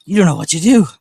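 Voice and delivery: high, loud sing-song to the dog. Real, not acted. Owner cooing to a confused dog, doggy baby talk. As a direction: coo it at the puppy.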